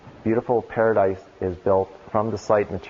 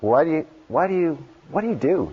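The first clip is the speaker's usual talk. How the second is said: higher, light imitation of a curious little girl; five-year-old asking; 6 semitones higher than usual. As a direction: ask like a small child, higher. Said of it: "She's like".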